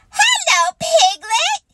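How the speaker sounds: high, excited little squeak; squeaky. A narrator's reading. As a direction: squeak it like a tiny eager joey. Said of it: "he squeaked".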